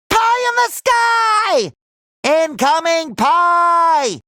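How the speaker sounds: high, long-drawn warning holler; warning call. Acted. Lookout warning of incoming pie, drawn-out high holler. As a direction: stretch each word out like a lookout.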